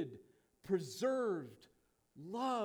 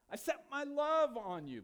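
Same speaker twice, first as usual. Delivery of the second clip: raised, emphatic preaching voice as God; high-pitched, insistent divine declaration; voicing God's declaration; 7 semitones higher, 4 dB louder than usual. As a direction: proclaim it high, then pose the question. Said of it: "God's statement to the nation of Israel".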